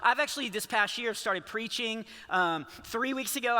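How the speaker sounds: ordinary talk, nothing marked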